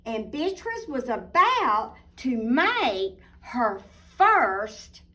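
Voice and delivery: put-on lively storybook narration for little girls; reading to children. A narrator's reading. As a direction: read it like a bedtime picture book. Picture book read aloud.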